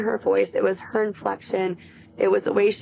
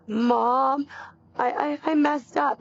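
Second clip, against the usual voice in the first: higher-pitched imitation of a sobbing daughter; stammering mock-tearful plea, pitched up; retold sobbing daughter; 4 semitones higher, slower than usual. Mother retelling her daughter's call, imitated sobbing. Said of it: "she's sobbing and crying".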